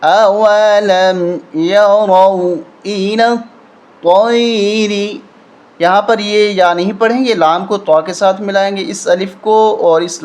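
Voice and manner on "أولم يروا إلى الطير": slow melodic tajweed recitation; Quranic recitation. Shown as a demonstration. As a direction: recite it slowly, drawn out, like a qari. Teacher recites Quran verse. (تلاوت کے انداز میں آہستہ)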